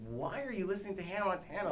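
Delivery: high incredulous director voice; imitating Quentin. Imagined director questioning his song choice, high and incredulous. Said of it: "what if Quentin was like".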